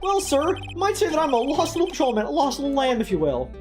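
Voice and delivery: high, earnest rookie cop voice; eager young patrolman. Acted. Voices patrolman character in game.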